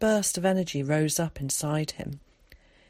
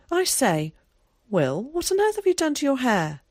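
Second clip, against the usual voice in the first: surprised; 8 semitones higher, 4 dB louder than usual. A narrator's reading. Zack surprised.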